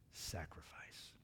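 slow, hushed whisper of wonder; awed whisper. Real, not acted. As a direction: let the word drop to a reverent whisper. Preacher awed by extravagant sacrifice.

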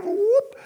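sudden high whoop; a quick rising whoosh like a flame; whoop sound effect. Pretend. Sound effect of God vanishing.

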